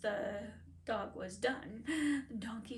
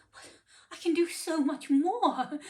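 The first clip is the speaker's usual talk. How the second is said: put-on higher, louder pleading old donkey; 5 semitones higher, 10 dB louder than usual. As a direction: beg the master to keep you.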